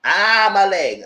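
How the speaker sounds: loud mock cry about the leg; hammed-up pained yelp; fake pain cry. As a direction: overplay the injury. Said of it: "play the sympathy card".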